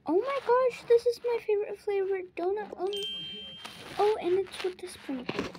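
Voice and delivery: high, loud, quick squeal of delight; excited delight. Acted. Sibling spotting a favorite donut among snacks, excited. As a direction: gush fast and high over a treat.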